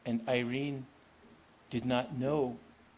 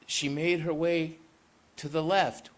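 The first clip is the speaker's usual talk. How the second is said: ordinary delivery, nothing marked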